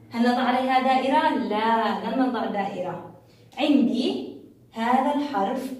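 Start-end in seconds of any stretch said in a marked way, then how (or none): none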